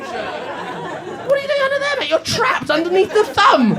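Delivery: hammed-up taunt, rising to loud; mock taunting fan. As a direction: imitate a pub heckler, slow and high. Mocking the fan's thumb taunt.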